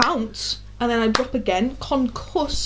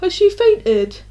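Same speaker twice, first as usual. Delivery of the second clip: higher and louder, blurted; imitating a startled question; 7 semitones higher, 6 dB louder, much slower than usual. Classmate turning round at the faint, loud and startled. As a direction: blurt it out in alarm. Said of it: "this other guy just turns around and goes".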